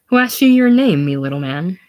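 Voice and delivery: scornful jab, loud start; mocking contempt; contemptuous. A narrator's reading. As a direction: sneer down at the little man. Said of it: "Hogan, contemptuously".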